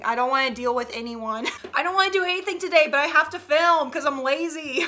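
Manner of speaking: normal talking voice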